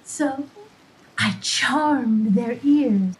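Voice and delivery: ordinary delivery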